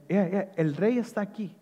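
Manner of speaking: low, soft, unhurried reassurance in character; calm Jesus. Acted. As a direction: speak gently as a king at peace. (Jesús hablando calmadamente, voz baja)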